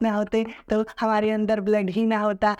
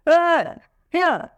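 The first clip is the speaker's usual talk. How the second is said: high, loud, offhand; a rude careless answer, done higher and louder; rude careless reply; 8 semitones higher, 4 dB louder than usual. Shown as a demonstration. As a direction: toss it off rudely. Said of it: "not like that".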